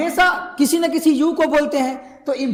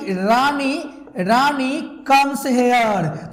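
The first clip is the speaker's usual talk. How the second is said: Rani comes here run together in one slow flow; one flow, drawn out; much slower than usual. Shown as a demonstration. Teacher showing the habit reading in one flow. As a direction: let it glide as a statement. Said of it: "अगर एक flow में बोल दिए तो".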